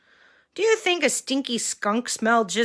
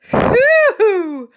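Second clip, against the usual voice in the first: high loud hooting laugh; 11 semitones higher, 8 dB louder than usual. Acted. Reacting to the skunk spray.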